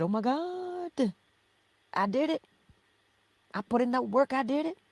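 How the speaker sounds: mock-elated self-praise; imitating joyful disbelief. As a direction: act out the thrill of realizing you made it. Acting out a proud realization.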